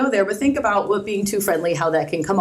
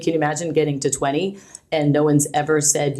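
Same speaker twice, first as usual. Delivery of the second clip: ordinary talk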